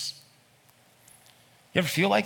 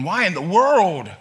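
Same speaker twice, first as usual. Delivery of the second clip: slow, loud, higher exasperated demand; exasperated parent; 5 semitones higher, 6 dB louder, slower than usual. Retold or imitated. Parent exasperated with their children, loud slow scolding. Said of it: "in exacerbation with your children".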